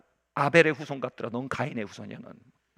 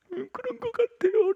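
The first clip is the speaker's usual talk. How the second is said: put-on girl's reply; 6 dB louder than usual. Crying girl answers.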